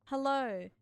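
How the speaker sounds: ordinary delivery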